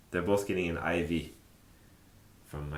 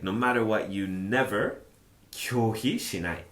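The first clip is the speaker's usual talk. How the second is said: usual speaking voice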